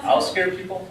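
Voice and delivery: high questioning echo on "scare"; incredulous echo. A narrator's reading. Tourist echoes his friend's reason, incredulous question on "scare". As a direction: throw it back as a baffled question.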